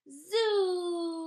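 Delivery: high, loud, long zoom; wailing engine zoom, drawn right out; zoom noise. Acted. Wheelchair in ninety-second gear races off, loud high zoom. Narrator imitates chair at top gear.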